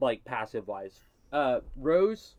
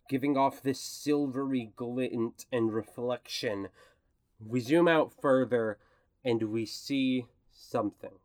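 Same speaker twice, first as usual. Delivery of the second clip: game master's scene setting; 4 semitones lower than usual. Acted. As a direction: narrate a closing camera pull-back in a lowered voice. Game master sets closing scene.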